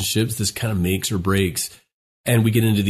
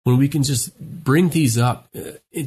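ordinary talk

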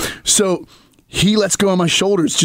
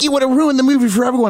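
mock scolding wife; 6 semitones higher, faster than usual. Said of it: "Tony's like".

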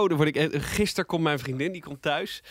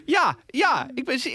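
whiny sick voice; 11 semitones higher, slower than usual. Retold or imitated. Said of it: "Dus ik".

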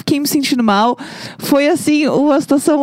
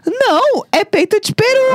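high-pitched shop clerk; 11 semitones higher than usual. Retold or imitated. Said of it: "E ele".

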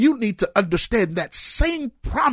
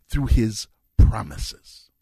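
slow emphasis; 6 semitones lower, much slower than usual. Real, not acted. Preacher slows key phrase.

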